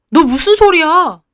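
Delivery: mildly alarmed disbelief; shocked question. A narrator's reading. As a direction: react in alarm to unexpected news. Husband shocked by talk of divorce.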